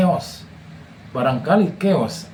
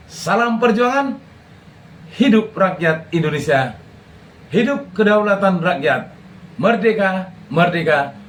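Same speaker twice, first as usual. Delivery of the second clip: rally speech; 3 semitones higher than usual. Acted. Closing chant to his viewers.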